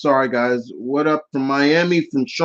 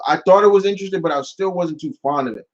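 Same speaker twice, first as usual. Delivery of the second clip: normal talking voice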